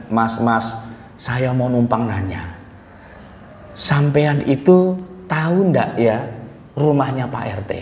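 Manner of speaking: soft, slow, humble Javanese stranger asking the way; polite Javanese newcomer. Retold or imitated. A Javanese newcomer asking a Betawi ojek driver, soft and slow. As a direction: meekly ask the ojek driver for directions. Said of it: "Orang Jawa datang ke Jakarta".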